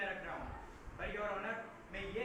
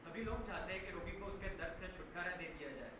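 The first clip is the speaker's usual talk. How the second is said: lower-pitched, subdued and grave; low, grave plea; 4 semitones lower, 5 dB quieter than usual. Acted. Lawyer describing a cancer patient's agony to the judge, low and grave. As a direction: lay out the suffering quietly and low.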